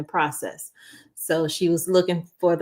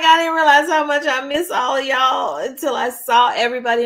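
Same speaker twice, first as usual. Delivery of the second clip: emotional outburst; 6 semitones higher, 5 dB louder than usual. Retold or imitated. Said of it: "I'm like".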